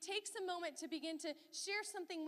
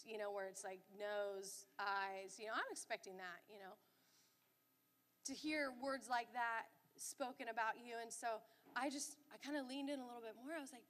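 ordinary delivery

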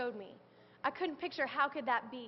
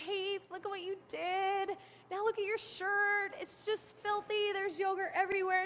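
Ben scolding; 7 semitones higher than usual. Retold or imitated. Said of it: "Ben looks at her and goes".

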